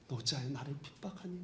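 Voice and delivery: low, soft tender question with a rise at 핍박하니; gentle Jesus. Retold or imitated. Jesus speaks to Paul, repeated. (핍박하니를 부드럽게 올리는 다정한 물음)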